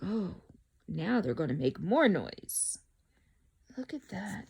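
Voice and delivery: ordinary delivery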